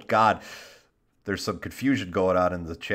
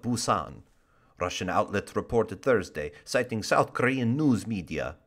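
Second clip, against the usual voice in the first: put-on hammy Russian-accented news read; 3 semitones higher than usual. Host reading a sailor story for laughs, put-on Russian accent. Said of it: "if I can do a Russian accent".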